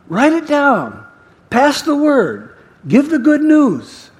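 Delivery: high, fervent reassurance; impassioned divine encouragement, strong and insistent; Jesus. Acted. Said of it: "this is Jesus".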